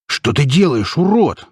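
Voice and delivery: high, outraged shout; indignant narrated yell. A narrator's reading. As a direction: yell it in angry disbelief, pitch jumping up. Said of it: "закричал бык на слона".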